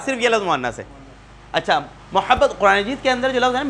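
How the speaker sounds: ordinary talk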